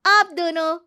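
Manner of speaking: high, loud announcement; spring the surprise on them; gleeful reveal. Acted. Granddaughter springs surprise on grandparents. (चहककर चौंकाते हुए)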